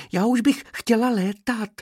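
high, eager little bee; impatient cry. A narrator's reading. Little bee begs to fly now, impatient and high. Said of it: "vykřikla netrpělivě jedna včelička".

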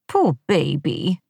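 slow, lightly voiced grating coo; drawn-out pitying baby talk; a hint of a cloying wife; irritating cooing voice. A narrator's reading. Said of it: "in her irritating voice".

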